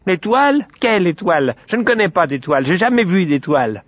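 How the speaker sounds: high, squeaky little pig voice; piglet feigning innocence. Acted. Piglet denies the star to the sun, feigned innocence. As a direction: play the cornered little liar, high-pitched.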